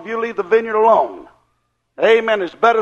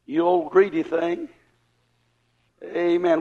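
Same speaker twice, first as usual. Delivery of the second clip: soft teasing rebuke; 6 semitones lower, 8 dB quieter than usual. Real, not acted.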